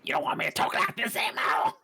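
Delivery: high, loud goblin radio host; shock jock voice. Acted. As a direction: ham it up like a goblin DJ. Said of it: "my goblin radio shock jock voice".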